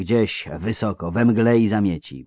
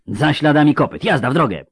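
loud, higher-pitched order; commanding call; 8 semitones higher, 6 dB louder than usual. A narrator's reading. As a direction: cut in and send the riders off. Geralt cuts in, orders riders off. (głośny, stanowczy rozkaz)

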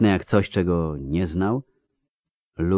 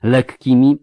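plain reading voice

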